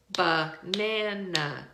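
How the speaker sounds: slow "banana" in three separate beats; syllable beats. Shown as a demonstration. Teacher explaining syllables, slow beat-by-beat "banana". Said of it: "it's like a beat".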